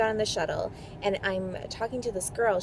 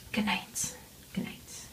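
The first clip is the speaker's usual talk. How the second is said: whispered good night; 9 dB quieter than usual. Real, not acted. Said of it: "whispered sign-off".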